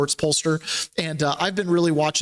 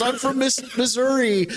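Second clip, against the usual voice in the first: higher-pitched mimicked reply; retold captive; 7 semitones higher than usual. Second captive naming a home state to the questioner, high-pitched mimicry.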